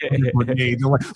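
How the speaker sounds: ordinary talk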